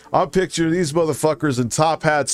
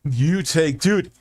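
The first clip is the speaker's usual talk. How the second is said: ordinary talk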